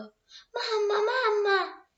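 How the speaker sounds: high, loud cry for mamma from a nightmare; frightened cry. Acted. Girl waking from a bad dream, crying out in fright. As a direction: call out for her mother in fear. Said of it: "gridò".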